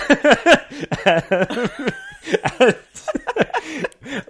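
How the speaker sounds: long, high-pitched burst of laughing; laughter. Real, not acted. Podcaster cracking up after imitating Terry, laughing. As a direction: laugh helplessly at your own impression.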